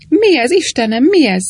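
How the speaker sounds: high, loud frightened outcry; alarmed cry. A narrator's reading. Sir Cecil cries out at the blaze, frightened and loud. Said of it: "kiáltott Sir Cecil Fanshawe".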